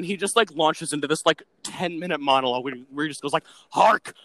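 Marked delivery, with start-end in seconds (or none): 3.7-4.1 loud, higher sailor cry; deckhand shouting over engine. Retold or imitated. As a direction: bellow it over the storm. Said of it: "I'm just yelling this".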